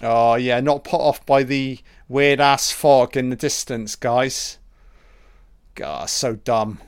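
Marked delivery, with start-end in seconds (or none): none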